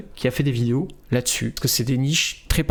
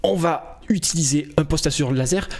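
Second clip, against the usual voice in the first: hyped-up video creator intro; rally the viewers with upbeat, rising energy; punchy sales patter climbing high in pitch; demo of lively voiceover; 3 semitones higher than usual. Shown as a demonstration. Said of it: "Je vais vous la faire".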